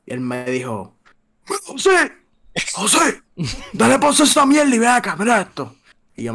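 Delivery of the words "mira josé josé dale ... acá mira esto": high excited calling of his dad, shouting over; calling his son over, pitched way up; father calling out. Retold or imitated. Padre que grita mirando el huracán. (padre llamando a su hijo, agudo y exaltado)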